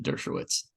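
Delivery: Dershowitz with an English W; author's own pronunciation. Shown as a demonstration. Telling brother how the author says it, Dershowitz with a W. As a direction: say Dershowitz as "DUR-sho-wits". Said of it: "I'm pretty sure he pronounces it".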